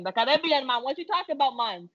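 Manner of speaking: lightly hammed-up Caribbean accent; mock Jamaican accent. Podcast host riffing on Jamaica with a friend, mock Jamaican accent.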